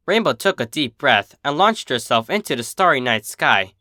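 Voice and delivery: plain reading voice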